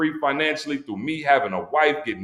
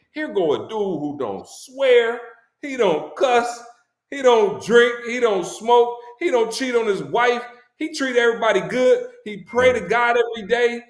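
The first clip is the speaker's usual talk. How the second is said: impassioned retelling; 9 semitones higher, 4 dB louder than usual. Retold or imitated. Retold rant praising his friend.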